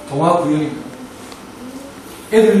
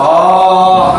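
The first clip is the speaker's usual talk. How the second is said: long, slow, loud belly-voiced "아"; slow booming "아" from the diaphragm; belly voice demo; 7 dB louder than usual. Shown as a demonstration. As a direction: project a sustained "아" from the belly. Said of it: "배에서 나오는 목소리로".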